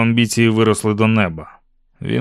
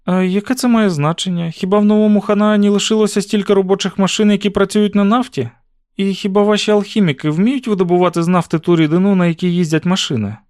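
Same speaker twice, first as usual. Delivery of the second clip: put-on higher-pitched girl firing questions; young heroine, curious and challenging; 9 semitones higher, 3 dB louder than usual. A narrator's reading. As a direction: press him with questions in a high girl's voice. Narrator voices Susan challenging Will. (високий голос дівчини, що допитується)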